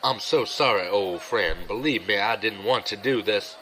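normal talking voice

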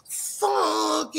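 Funky drawn out up high; mock Funky, said the old-school way. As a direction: stretch out Funky, pitched well up. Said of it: "it was just the way he said it".

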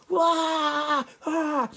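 loud, high mock screams; hammed-up shrieks of alarm; panicked workers' wail. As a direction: wail while fleeing in pretend panic. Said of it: "they go running off into the woods".

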